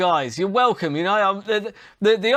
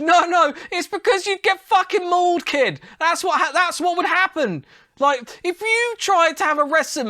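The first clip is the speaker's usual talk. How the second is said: heated retort; 9 semitones higher than usual. Real, not acted. Mocking retort to Jake Paul.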